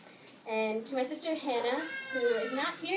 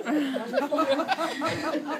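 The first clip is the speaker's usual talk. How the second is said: laughing gush; 6 semitones higher, 5 dB louder, much slower than usual. Real, not acted. Gushing thanks to host mother.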